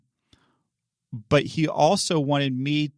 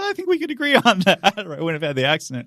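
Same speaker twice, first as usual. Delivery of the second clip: laughing admission; 6 semitones higher, 4 dB louder, faster than usual. Retold or imitated. Admitting guilt to officer.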